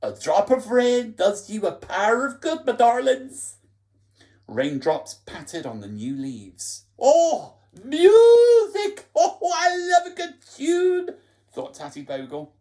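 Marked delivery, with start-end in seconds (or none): 0.0-3.5 higher, louder cooing character voice; thin whispery voice. Acted. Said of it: "A thin, whispery voice". 7.0-11.2 very high, loud, laughing joy; delighted laughing squeal. Acted. Tatty Bogle delighted by rain.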